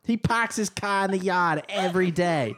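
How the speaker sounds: put-on broad, R-dropping Boston accent. Joking about the most Boston guy, thick Boston accent. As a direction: drop every R like a Bostonian. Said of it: "the most Boston guy ever".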